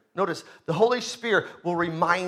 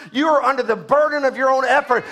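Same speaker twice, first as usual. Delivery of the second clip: ordinary delivery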